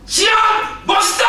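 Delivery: throat-bursting cheer for someone you love; all-out cheering shout. Shown as a demonstration. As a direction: yell it at the top of your lungs, high and loud. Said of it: "이러면서 막 소리 지르는 거예요". (목이 터져라 외치는 응원)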